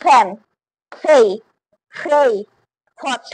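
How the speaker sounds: ফ্যান, ফেই, ফ্রেই, ফট each opening on an airy ph; aspirated P. Shown as a demonstration. Student reciting rule examples, aspirated ph in ফ্যান, ফেই, ফ্রেই, ফট. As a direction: puff air at the start of ফ্যান, ফেই, ফ্রেই, ফট. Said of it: "ফ এর মতো উচ্চারণ করব".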